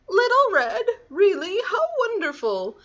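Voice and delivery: put-on high, excited old lady; delighted grandmother. Grandmother hearing Little Red at the door, high delighted voice. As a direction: exclaim in happy surprise, pitched high.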